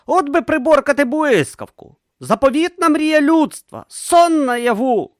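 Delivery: high, excited inventor voice; eager young inventor. A narrator's reading. Urn dreaming of taming lightning, high and eager. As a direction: dream out loud, high and bright.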